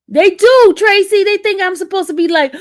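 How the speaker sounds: high and loud complaint; animated. Real, not acted. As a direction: exclaim it up high.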